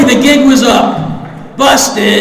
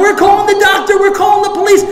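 mock yell, much higher; yelling threats; 11 semitones higher, faster than usual. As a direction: shout threats at him. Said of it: "they're all yelling at me".

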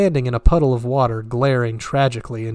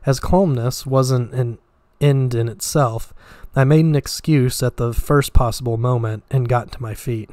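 ordinary delivery

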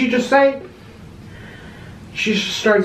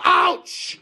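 high-pitched cry of pain; pained yelp; 9 semitones higher than usual. Pretend. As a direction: cry out as if just hurt. Hurt again in staged scuffle.

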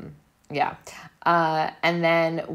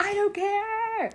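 much higher, slow, put-on voice of total indifference; dismissive; slower than usual. Mimicking the ex-wife brushing off her husband, mock high dismissive. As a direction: toss it off in a high mock voice, slowly. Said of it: "then she's just like".

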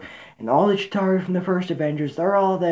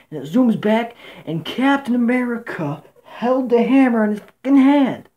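rising thrill, much higher and louder; excited; 7 semitones higher, 4 dB louder than usual. Real, not acted. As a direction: blurt it out like the best scene ever. Thrilled retelling of movie moment.

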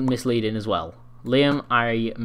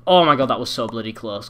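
usual speaking voice

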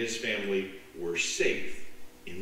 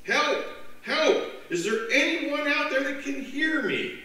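high, loud cry for help; stranded man screaming up for help; frantic scream; 4 dB louder than usual. Acted. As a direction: yell it from the cliff, panicked. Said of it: "he began to scream".

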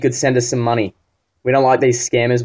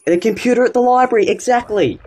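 much higher, hammed-up relief; mock eager; 9 semitones higher than usual. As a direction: pounce on the excuse like a bad actor. Scammer eagerly grabs the excuse.

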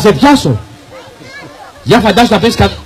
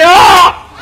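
shout; 7 semitones higher than usual. Shown as a demonstration. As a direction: show everyone how to shout it. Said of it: "μπορεί να το μεγαλώσεις φωνάζοντας".

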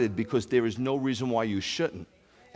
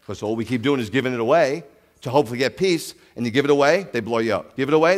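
ordinary delivery

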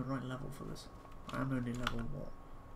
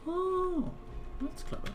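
surprised; 6 dB louder, slower than usual. Real, not acted. Sudden delighted realisation.

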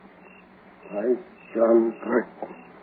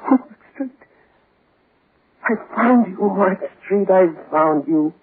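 frantic triumph; 6 semitones higher than usual. Acted. Finding the grave, frantic triumph.

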